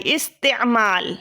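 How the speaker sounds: استعمال stretched out slowly; slow dictation. Shown as a demonstration. Teacher showing the same shape, slowly dictating. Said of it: "دیکھیں، same shape نکل آئی".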